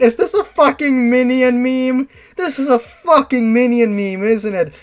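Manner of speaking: loud, high incredulous outburst; can't believe it's a meme; loud cursing disbelief at the shirt. Real, not acted. Reader reacts to meme.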